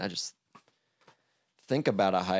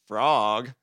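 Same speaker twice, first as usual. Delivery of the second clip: louder, moderately raised call; loud hail to a friend; crying out; 4 semitones higher, 5 dB louder than usual. A narrator's reading. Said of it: "cried Toad".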